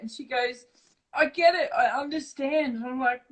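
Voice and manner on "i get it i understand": high, tearful mock sob-voice; imitating blubbering. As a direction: mimic a crying mum, pitched up. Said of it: "blubbering like this".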